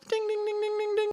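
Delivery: a little chime, high and soft; high soft ding-ding bell. Acted. GM rewarding a player with a high soft chime. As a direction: ring a tiny reward bell.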